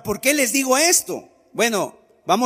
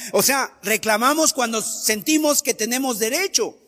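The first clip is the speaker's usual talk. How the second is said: ordinary talk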